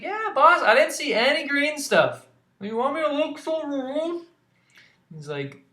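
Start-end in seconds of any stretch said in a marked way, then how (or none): 0.0-4.3 much higher, loud sidekick shout; excited kid calling across rooms; Nimona calling out. Acted. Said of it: "She's like".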